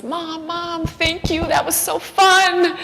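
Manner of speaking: put-on a happy kid thanking his mom; excited kid. Son thanking mom, voiced by her.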